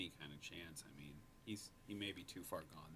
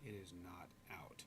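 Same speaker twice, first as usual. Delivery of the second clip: whispering; 3 semitones lower, 9 dB quieter than usual. Real, not acted. Tense watching of the shot.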